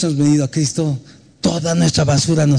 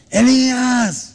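calling out a name; 8 semitones higher, much slower than usual. Retold or imitated. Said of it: "Aquí le gritaba".

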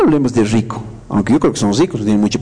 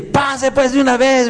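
impatient lady; 11 semitones higher than usual. Retold or imitated. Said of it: "Y la señora dice".

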